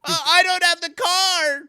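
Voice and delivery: high, loud mocking whine; mock a lazy customer's excuses; whiny customer. Mocking a customer's excuses, high whiny voice.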